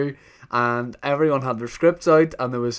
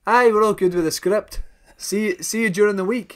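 higher-pitched mock Scottish accent; mimicked Scottish accent; 6 semitones higher than usual. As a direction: mimic the producer's Scottish lilt, exaggerated. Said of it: "started mimicking without knowing it's somebody's accent".

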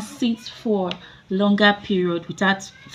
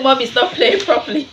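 mock child quote; 4 semitones higher, 7 dB louder than usual. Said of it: "I hope you will not say".

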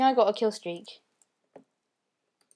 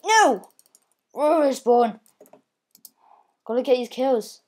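usual speaking voice

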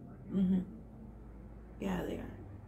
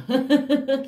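louder laugh of amazement; 3 semitones higher, 5 dB louder than usual. Real, not acted. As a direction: laugh out loud in awe. Awed by someone's looks.

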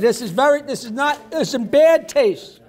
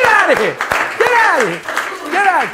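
higher, louder get-out-of-here; laughing disbelief; 7 semitones higher, 6 dB louder than usual. Real, not acted. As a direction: shout it in delighted disbelief. Host reacting to joke.

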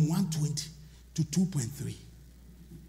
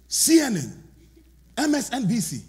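ordinary delivery

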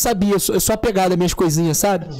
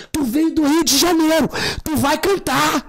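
mock pushy yell; 12 semitones higher than usual. Friend yelling to make him sing.

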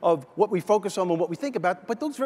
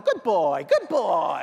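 imitation of a parrot praising a dog; drawn-out mock praise in a bird voice; talking parrot; much slower than usual. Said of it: "the bird's looking at him going".

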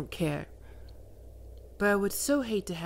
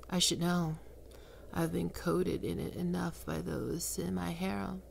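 ordinary talk, nothing marked

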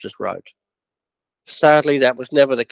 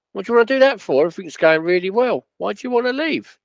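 high, incredulous questioning; baffled disbelief; 8 semitones higher than usual. Retold or imitated. Chairman baffled his manager wants to leave, high incredulous. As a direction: sound like you can't believe he'd quit.

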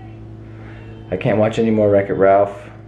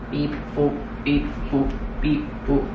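toy robot voice; 3 semitones higher, 6 dB quieter than usual. Pretend. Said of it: "I am an alien robot".

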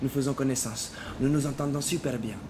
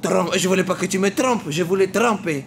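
high, loud outraged yelling; angry shout; 7 semitones higher, 7 dB louder than usual. Retold or imitated. Fares shouting at the girl who set him up, high and loud. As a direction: yell it in frustration. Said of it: "Je lui dis".

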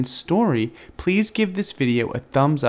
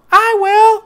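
very high, loud squeak; eager mouse squeak; 8 dB louder than usual. A narrator's reading. The mouse begging for cake, high squeak. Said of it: "cried the mouse".

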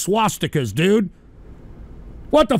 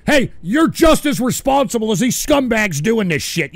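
ordinary talk

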